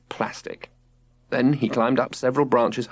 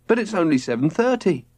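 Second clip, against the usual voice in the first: put-on child protesting; 7 semitones higher than usual. A narrator's reading. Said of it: "protested Robert".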